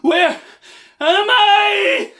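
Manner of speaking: loud, high shout. Acted. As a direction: belt it out. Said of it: "Say it with me".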